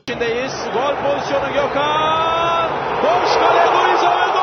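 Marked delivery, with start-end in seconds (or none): 3.0-4.4 goal shout. Real, not acted. Goal called.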